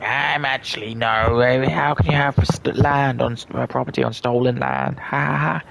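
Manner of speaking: high put-on mocking girl voice, stumbling. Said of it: "She's like".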